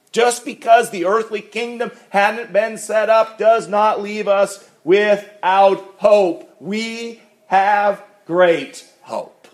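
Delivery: high, loud preaching build; fervent preaching. Acted. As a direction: lift it up like a pulpit climax. Preaching at full force.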